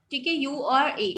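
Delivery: ordinary talk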